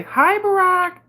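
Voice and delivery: high, loud mimicked hello; mock greeting. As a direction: put on a high-pitched, gushing greeting. Mimics woman greeting Barack.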